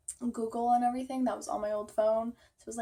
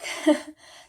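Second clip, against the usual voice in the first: laugh; 8 dB louder than usual. Real, not acted. Laughing at her own worry.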